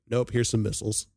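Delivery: ordinary delivery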